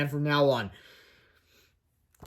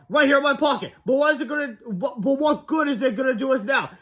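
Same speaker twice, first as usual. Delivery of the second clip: frantic; 8 semitones higher than usual. A narrator's reading. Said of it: "screamed Cheese Ball".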